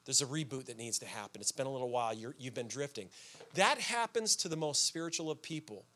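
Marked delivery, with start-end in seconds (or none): 0.0-3.1 low, soft device-like notification voice; his soul, like a phone, quietly telling Steve to reboot; soul's update notice. Pretend. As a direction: read it like a quiet system alert. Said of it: "pop up on my screen to say".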